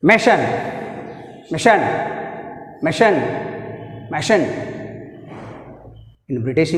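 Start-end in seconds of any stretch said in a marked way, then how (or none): 0.1-4.5 "machine" as ma-SHAN, second half stressed; stress on second syllable. Shown as a demonstration. Teacher drilling stress for students, strong second syllable in machine. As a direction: repeat "machine" leaning on the CHINE. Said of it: "second syllable సి మీద".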